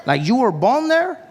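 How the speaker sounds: can't believe what he heard; incredulous. Retold or imitated. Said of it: "He's like".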